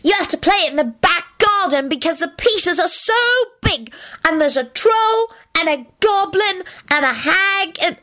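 put-on high-pitched, squeaky little-kid voice; excited small child. Teddy tells his aunt about tournament, excited. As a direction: gush like an overexcited little boy.

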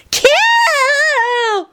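put-on high gleeful child's shout; joyful holler. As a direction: holler it with glee. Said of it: "Annie hollered joyfully".

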